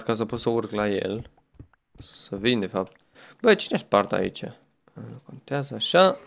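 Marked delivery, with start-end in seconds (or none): none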